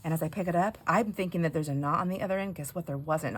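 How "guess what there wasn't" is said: ordinary delivery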